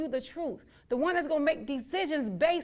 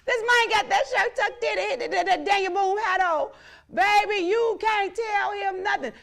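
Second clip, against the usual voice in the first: excited roast; 6 semitones higher, 5 dB louder than usual. Real, not acted. Roasting rival's outfit, amused.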